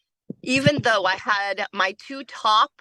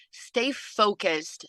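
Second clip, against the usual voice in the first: slow, deliberate advice; 3 dB quieter, much slower than usual. Shown as a demonstration. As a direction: Stay focused said very slowly and a little softer. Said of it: "repeated advice, first take slowed".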